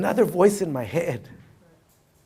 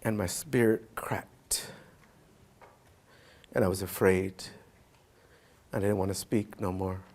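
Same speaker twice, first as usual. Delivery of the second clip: hushed whisper; 9 semitones lower, 10 dB quieter than usual. Real, not acted. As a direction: let the words fall into a whisper. Painful memory, voice drops.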